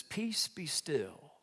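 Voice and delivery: slow, soft, hushed words; calming command. Acted. God speaking to the storm, calm and hushed. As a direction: still the storm gently and slowly.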